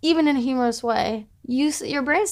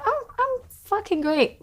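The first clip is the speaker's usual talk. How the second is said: fake cheer; 10 semitones higher than usual. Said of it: "she'll call me and be like".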